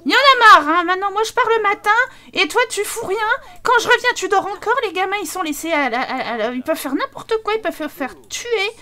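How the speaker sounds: put-on wife yelling that the kids are left alone; angry wife. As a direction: lay into the lazy husband. Voicing wife in quarrel.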